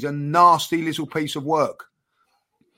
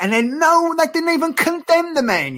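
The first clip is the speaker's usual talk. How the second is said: exasperated, pitch climbing well above normal; indignant outburst; 13 semitones higher, 3 dB louder than usual. Real, not acted. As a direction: voice shoots up high in disbelief. Indignant rant about pundits.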